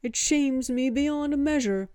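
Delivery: loud, high-pitched monkey devotee; high, booming proclamation from a devoted ape; booming gibbonish voice. A narrator's reading. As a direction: boom it out like a grand ape herald. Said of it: "The monkey interjected".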